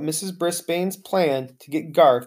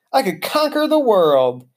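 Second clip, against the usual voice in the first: high, loud, swelling exclamation; elated, soaring declaration; triumphant boast; 5 semitones higher, 4 dB louder than usual. A narrator's reading. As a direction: proclaim it like a conqueror. Aldo boasts of his energy.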